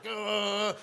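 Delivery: high, drawn-out groan; frazzled nerves noise. Retold or imitated. Storyteller voicing their nerves on fire, high frazzled groan. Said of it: "my nerves are just like".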